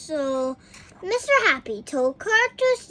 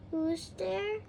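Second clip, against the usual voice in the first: high and soft; a small sad voice; sad little voice; 5 semitones higher, 8 dB quieter than usual. A narrator's reading. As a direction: ask weakly through the door. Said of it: "asked a sad little voice".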